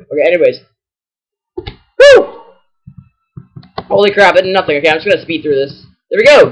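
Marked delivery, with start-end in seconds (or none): 2.0-2.4 sudden high, loud whoop of breath; high whew. Real, not acted. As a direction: let out a high-pitched whew. Relief after battle move.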